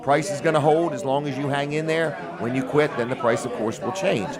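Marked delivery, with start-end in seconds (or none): none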